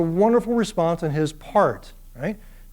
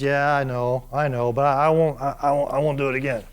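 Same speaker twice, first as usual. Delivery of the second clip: sheepish Abraham; 5 semitones lower than usual. Acted. Sheepish Abraham admits fault.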